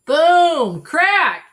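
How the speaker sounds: big booming crash words; loud sound effects. A narrator's reading. Narrator booming the crash sounds.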